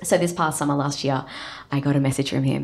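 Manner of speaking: ordinary delivery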